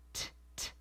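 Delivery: "T, t" breathed out voiceless; whispered t sound. Shown as a demonstration. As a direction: show the t release without voice. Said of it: "We're releasing at the alveolar ridge".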